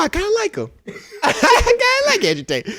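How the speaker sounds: high laughing squeal; giggly mock admission; teasing provocation. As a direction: bait him while cracking up.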